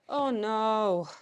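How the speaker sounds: dismayed oh no. Real, not acted. Finds the toy lost an ear.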